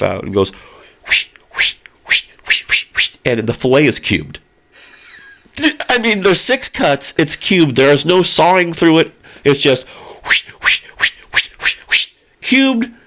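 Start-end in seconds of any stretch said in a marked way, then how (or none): 1.1-4.4 knife whooshes. Retold or imitated. Imitates the knife cutting. 10.6-12.9 knife whooshes. Retold or imitated. Imitates the knife cutting again.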